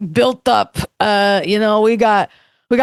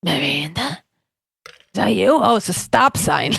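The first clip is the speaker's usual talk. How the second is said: Steve voice; 6 semitones lower, 3 dB quieter than usual. Acted. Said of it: "I wanna try the Miranda".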